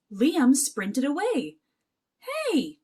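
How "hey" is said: high, sharp call after a runaway; yelled. Acted. Jack yelling at the fleeing leprechaun, high-pitched shout. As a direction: shout it up high to stop him. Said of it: "Jack yelled".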